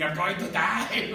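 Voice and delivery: loud, high retold cry that she's going to die; retold scream. Girlfriend panicking on a rooftop trip, half-mock loud scream. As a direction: yell it out as if panicking on ayahuasca. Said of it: "my girlfriend at the time screaming".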